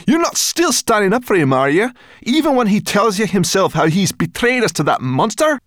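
high, loud outraged yelling; furious. Acted. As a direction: rage at him, outraged. Sorrel enraged at Ben.

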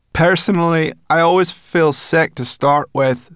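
loud, high brownie voice; Sorrel the brownie. A narrator's reading. Sorrel advising Ben in flight, loud and matter-of-fact. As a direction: chatter loudly as a brisk brownie.

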